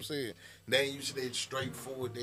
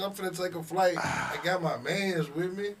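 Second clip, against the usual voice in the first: hype host voice; 7 semitones higher, 4 dB louder than usual. Shown as a demonstration. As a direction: announce it like a hype man. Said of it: "Like I said".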